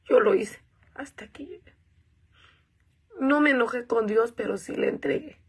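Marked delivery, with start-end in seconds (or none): 0.9-1.8 much softer, voice almost gone; barely voiced surrender. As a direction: let it die away in surrender. Emotional surrender line.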